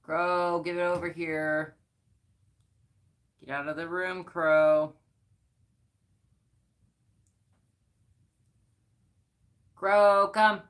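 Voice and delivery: loud, high call to the pet. Real, not acted. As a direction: call the pet firmly across the room. Calling her pet out of room.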